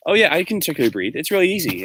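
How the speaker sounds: high, fast, smug braggart voice; mock bragging player. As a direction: toss it off like showing off to viewers. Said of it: "the video where he's like".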